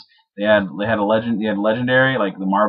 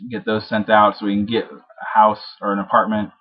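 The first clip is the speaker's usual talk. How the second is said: ordinary talk, nothing marked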